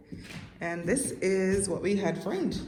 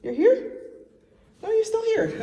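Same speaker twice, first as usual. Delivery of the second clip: high, loud surprised laugh; amused surprise at the bug; 4 dB louder than usual. Real, not acted. Bug still in the room.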